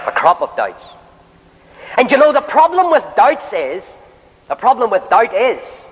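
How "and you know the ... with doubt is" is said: fervent preaching. Acted. Preacher rousing the meeting about Elijah, fervent and raised. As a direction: lift the hall with rising preacher cadence.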